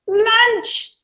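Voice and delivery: high, loud cry of glee; a happy little bird spotting lunch; gleeful cry. Acted. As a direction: shout it with sheer delight. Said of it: "cried the cockapoo with glee".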